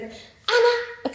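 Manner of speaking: high, sing-song name call; teasing friend. Retold or imitated. Friend teasing her that same night, high playful call.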